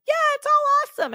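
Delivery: squeaky-high, loud and fast fake cheer; mock carefree. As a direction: toss it off like nothing's wrong, high and bright. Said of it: "Paul wasn't just running around like".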